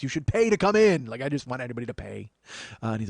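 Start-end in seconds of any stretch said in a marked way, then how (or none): 0.0-1.1 louder, higher mock demand; mock insistence. Doorman telling French guy to pay, mock insistent. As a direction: press the point with raised voice.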